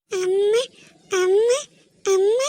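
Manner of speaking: put-on small child wailing for her mother, high; a little girl calling amma through tears; crying child voice; crying toddler.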